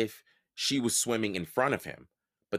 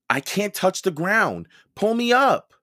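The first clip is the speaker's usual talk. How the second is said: much higher and louder, rising into a desperate cry; panicked call for help; 9 semitones higher, 8 dB louder than usual. A narrator's reading. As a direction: yell up to your sister before your grip gives out. Hanging from ledge, begging for help.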